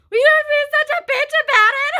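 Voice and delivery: very high, loud, fast indignant yell; shrill angry retort. Retold or imitated. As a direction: fire it back, outraged and shrill. Retold retort after being hung up on.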